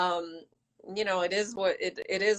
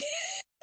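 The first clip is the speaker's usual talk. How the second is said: high laugh. Real, not acted. Laughs after apology.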